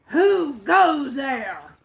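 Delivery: a hungry troll grumbling from under the bridge. Acted. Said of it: "grumbled the hungry troll".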